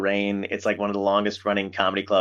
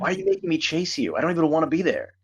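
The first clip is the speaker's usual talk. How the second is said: higher pitched, incredulous mock complaint; exasperated rant; 5 semitones higher than usual. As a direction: vent like you can't believe it. Exasperated quote about club owners.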